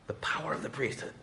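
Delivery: hushed reverent whisper; whispered wonder at the priesthood; awed whisper. Real, not acted. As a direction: breathe it with awe. Awed whisper mid sermon.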